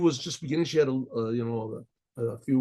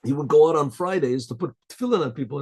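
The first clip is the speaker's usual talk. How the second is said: ordinary talk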